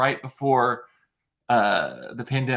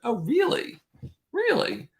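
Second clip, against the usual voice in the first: high-pitched, retold double take; questioning, pitched way up in surprise; surprised. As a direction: replay your surprise at unexpected news. Retold reaction to surprising news.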